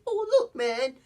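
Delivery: imitating Axel's high, over-the-top fake character; fake persona voice. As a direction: play a con man slipping into a silly cover voice. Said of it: "pretending to be a strategy".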